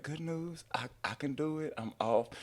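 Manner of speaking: hushed, sweet retelling of her words; sweet wife. Retold or imitated. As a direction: imitate her gently sharing good news. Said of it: "my wife's so sweet".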